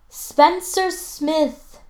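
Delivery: teacher's stern shouted name; teacher yelling. A narrator's reading. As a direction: call him out across the classroom. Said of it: "Miss Elliot yells".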